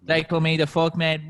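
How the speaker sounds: high, loud opening greeting to listeners; podcast host intro. Acted.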